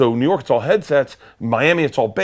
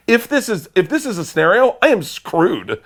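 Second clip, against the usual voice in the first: panicked thought; 10 semitones higher, 4 dB louder than usual. Retold or imitated.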